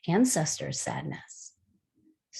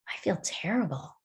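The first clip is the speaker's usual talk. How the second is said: retold in a mild whisper; imitating a glum boy; 5 dB quieter than usual. Teacher retelling what a sad youth said, a soft glum whisper. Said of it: "he just was like".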